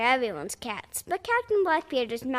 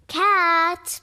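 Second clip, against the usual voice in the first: louder, higher call; captain calls his cat; 6 semitones higher, 7 dB louder than usual. Acted. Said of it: "Blackbeard called".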